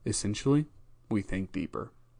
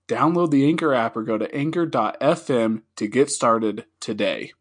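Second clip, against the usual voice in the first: louder, bright sponsor announcement; upbeat ad read; 4 semitones higher, 6 dB louder than usual. Acted. Podcast host reading a sponsor ad for listeners, upbeat and louder. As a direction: sell it like a friendly ad spot.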